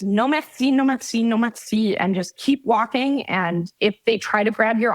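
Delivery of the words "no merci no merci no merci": French accent. Shown as a demonstration. Tourist refusing bracelet sellers while walking on, French accent. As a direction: chant it in French, brushing someone off. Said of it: "in your best French accent".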